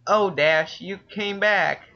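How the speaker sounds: high, drawn-out happy cry; excited exclaim. Acted. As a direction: greet her like a long-lost friend. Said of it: "Applejack exclaimed".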